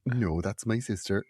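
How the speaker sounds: soft, slow, unbothered retelling; calm and even. Retold or imitated. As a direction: say it evenly, as if nothing is odd. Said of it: "everything was so calm and so even".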